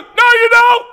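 very high, loud shout; retold man's shouted no; shouted denial. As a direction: yell it back at him. Man shouting back.

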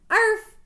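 one loud, high "arf"; dog bark. Acted. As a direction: yelp it like a happy dog. Boy barks like a dog.

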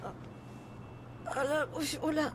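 gémissement de douleur. Acted. Victime qu'on fait asseoir.

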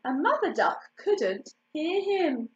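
plain reading voice

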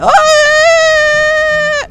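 loud, very high sustained cry; sustained high shout; high held "oh". Real, not acted. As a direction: belt a long high note at the mic. Voice-controlled game, singing loud.

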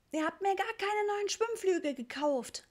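put-on very high childish wail; whining complaint. A narrator's reading. Richie complaining about the water wings, whining. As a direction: whine it high, like a child in a sulk. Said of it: "maulte Richie".

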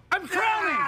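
very high, strained yell; pretend labour scream. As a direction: shriek through a mock delivery. Pretend birth, yelling at coach.

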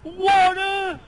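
high, loud, stunned outburst; shocked shout. Real, not acted. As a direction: blurt it out in shock. Shocked reaction to the scare.

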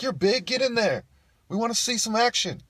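high, loud heckler's shout; egging-on crowd. Acted. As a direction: goad him loudly from the crowd. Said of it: "people in the crowd are like".

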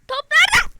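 loud, high yell; shout. Real, not acted. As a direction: shout for the ball. Topu arayan bağırış. (topu arayan bağırış)

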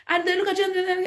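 ordinary delivery

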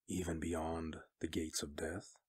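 slow, hushed question. A narrator's reading. Stark questioning her about the gates of death, slowly. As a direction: ask it softly and unhurriedly. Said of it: "Stark said slowly".

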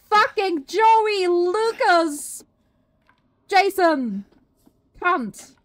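loud, high, slow furious curses; furious yelling. Real, not acted. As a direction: yell at the enemy in rage. Cursing the attacking enemy.

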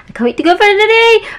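high, fast excited squeal. Real, not acted. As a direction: burst out with giddy excitement.

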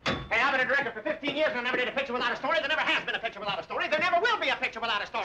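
fast, insistent tirade; quick-fire protest; rapid rant. Acted. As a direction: rattle it off indignantly. Director rants about stories.

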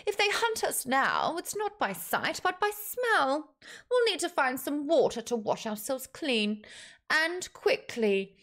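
high-pitched, deliberate orc leader. Acted. Orc leader's dialogue in story.

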